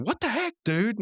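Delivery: high-pitched mock outrage; Mordecai. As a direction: imitate a baffled, indignant gamer. Mordecai finds he cannot move.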